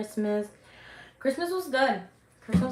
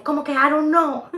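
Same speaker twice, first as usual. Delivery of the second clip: higher, louder indignant dad; mock angry dad; 7 semitones higher, 7 dB louder than usual. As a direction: snap it back like a strict father. Angry dad questions her.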